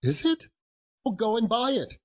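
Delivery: high-pitched, delighted command; eager order. Acted. Scrooge sends the boy to buy it, excited. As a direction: burst out with sudden eagerness.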